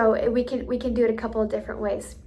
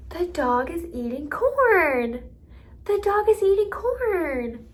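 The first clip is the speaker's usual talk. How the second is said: slow, loud, high singsong, CORN stretched; teacher showing a child, CORN highlighted; modeling with emphasis; 7 semitones higher, 6 dB louder, slower than usual. Shown as a demonstration. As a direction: swoop up on CORN and draw it out. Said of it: "I'll model it one more time".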